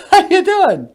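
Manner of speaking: very high, loud squeaky alien. Acted. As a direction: greet him like a tiny cartoon alien.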